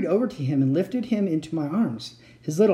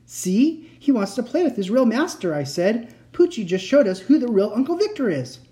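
excited; 7 semitones higher than usual. A narrator's reading. Excited triumph.

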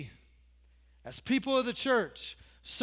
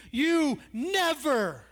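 drawn-out indignation; 4 semitones higher, 4 dB louder, much slower than usual. Acted. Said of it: "He's all".

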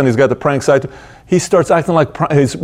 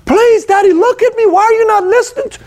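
put-on very high, desperate child's plea; imitated shriek of a panicked son; imitating tearful screaming. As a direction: plead frantically like a screaming kid. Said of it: "And tears are coming".

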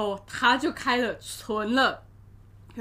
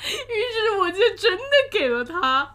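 much higher and louder, laughing; laughing cry; 8 semitones higher, 8 dB louder than usual. Real, not acted. Admitting she gave the customer her line, laughing wail. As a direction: wail the confession through laughter.

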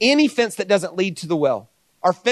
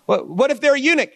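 lightly voiced doubter from the crowd; mock questioner; 8 semitones higher than usual. Voicing a questioner in the Q&A.

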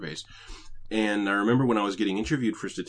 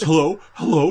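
loud and higher, calling into a dead line; frantic hello; 9 semitones higher, 13 dB louder than usual. Retold or imitated. Call centre guy calling to a caller who hung up, loud. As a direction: shout hello, getting no answer. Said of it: "one of the guy goes".

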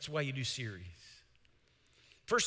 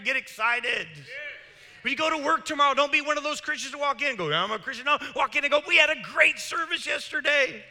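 loud, high, driving preacher voice; full-force preaching; 3 semitones higher, 4 dB louder than usual. Acted. Pastor rallying the congregation, preaching loud and high. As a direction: preach it out to the back row.

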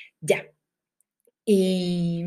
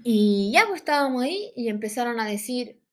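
ordinary talk

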